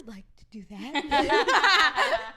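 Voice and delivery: laughing request. Retold or imitated. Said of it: "And I was like".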